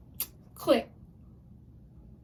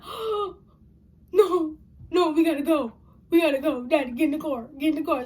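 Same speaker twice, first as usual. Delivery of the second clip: panicky plea; 4 semitones higher, 6 dB louder than usual. Retold or imitated. Begging dad to flee sirens.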